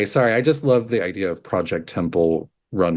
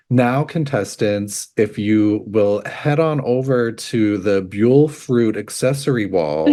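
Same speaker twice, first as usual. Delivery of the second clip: slow, drawn-out host announcement; Sethos as show host. Pretend. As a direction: address the contestants like a reality-show host, unhurried. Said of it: "Sethos or someone being like".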